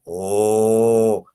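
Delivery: "O" held long on one level note; sustained O vowel. Shown as a demonstration. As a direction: stretch "O" as a steady rounded back vowel. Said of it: "luego cambia O".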